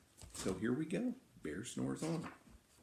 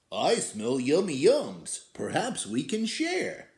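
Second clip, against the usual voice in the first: louder, rising badger voice; 4 semitones higher, 5 dB louder than usual. Badger sniffing the air, asking to share, louder and higher. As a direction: sniff around like a hungry badger. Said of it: "A badger scuttles by".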